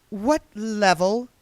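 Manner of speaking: put-on high, slow, superior young woman; haughty young witch. A narrator's reading. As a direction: look down on the old witch. Diamanda voiced, haughty young witch.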